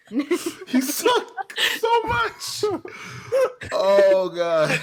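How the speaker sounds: laughing so hard it squeaks; high laughing, slow and stretched. Real, not acted. Laughing at the players.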